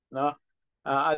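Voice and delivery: normal talking voice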